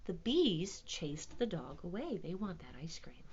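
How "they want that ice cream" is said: usual speaking voice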